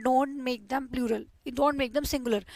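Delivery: very slow, soft warning; slow, emphatic rule. Shown as a demonstration. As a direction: spell the rule out slowly. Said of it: "teaching rule slowly". (धीमे स्वर में नियम समझाना)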